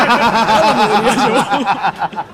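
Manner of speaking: repeated slowly through laughter; laughing. Real, not acted. Host repeats the stoner's plea to the patrol while laughing. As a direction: laugh the line out, drawn out.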